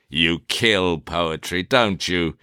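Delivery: ordinary delivery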